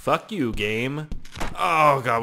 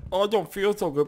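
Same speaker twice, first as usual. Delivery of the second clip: retold queasy waking; 7 semitones higher than usual. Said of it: "when I woke up, I was like".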